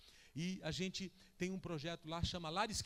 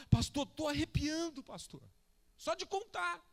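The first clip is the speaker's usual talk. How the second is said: high, loud, impassioned sermon delivery; full-force preaching; 9 semitones higher, 7 dB louder than usual. Acted. Pastor telling the church of a new believer's testimony, full-force preaching. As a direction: drive the point home to the congregation.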